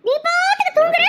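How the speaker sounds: scolding. Acted. Stepmother yells at sleeping girl.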